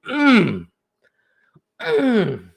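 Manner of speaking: ordinary talk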